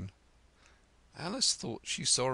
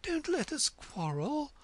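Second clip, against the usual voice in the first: anxious tone. A narrator's reading. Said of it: "in an anxious tone".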